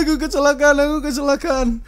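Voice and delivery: high, loud fake crying wail; mock sobbing. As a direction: ham up the scammer's sob. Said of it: "Yang satu".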